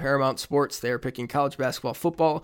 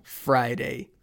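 slow announcer intro; 4 semitones lower, much slower than usual. Acted. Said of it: "slow segment intro".

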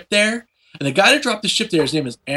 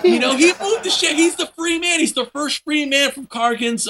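excited; 10 semitones higher than usual. Real, not acted. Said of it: "Oh my God".

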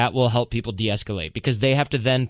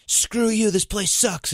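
mock angry customer; 10 semitones higher, slower than usual. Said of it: "if someone's like".

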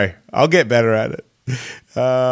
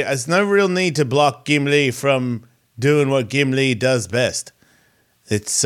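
ordinary talk

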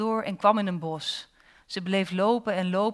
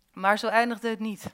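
usual speaking voice

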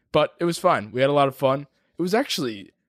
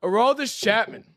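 ring announcer; 11 semitones higher than usual. Acted. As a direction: boom the fighter's name. Finishes the fighter introduction.

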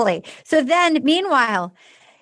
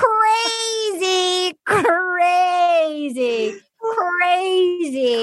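mock crazy; 4 semitones higher, much slower than usual. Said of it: "And I wrote out".

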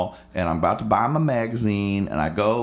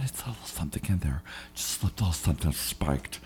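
much softer, fast conspiratorial murmur; hushed aside; 5 semitones lower, 9 dB quieter, faster than usual. Retold or imitated. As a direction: drop it low as a secret. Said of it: "conspiratorial aside".

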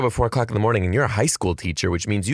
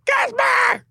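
shouted intro; slower than usual. Real, not acted. Host calling out guest's name.

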